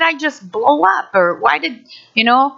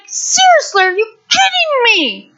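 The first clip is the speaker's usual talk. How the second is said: high exasperated cry of disbelief; fed up, pitch it up high; frustrated outburst; 11 semitones higher than usual. Retold or imitated. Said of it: "I started getting frustrated".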